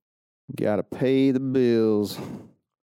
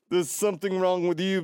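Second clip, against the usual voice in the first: higher-pitched snooty put-on voice; stupid voice; 6 semitones higher than usual. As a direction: play a silly, superior snob. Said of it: "for me to do that stupid voice".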